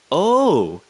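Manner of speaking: loud, high "oh" of dawning understanding; sudden realisation. Retold or imitated. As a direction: act out the penny dropping. Retells a moment of realisation.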